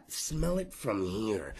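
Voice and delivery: low, slow murmured jab; muttered aside. Real, not acted. Aside about drunk audience member.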